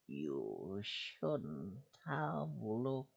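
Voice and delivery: very slow, soft, menacing monster voice; icy malicious whisper. Acted. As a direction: draw each word out like a cold threat. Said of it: "in an icy, malicious voice".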